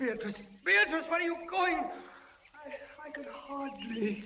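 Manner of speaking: panicked calling. Acted. Beatrice's voice fading away.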